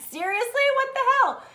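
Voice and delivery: high, loud frustrated exclamation; mock-outraged self-scolding; exasperated. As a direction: throw up your hands at yourself. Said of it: "when you're just like".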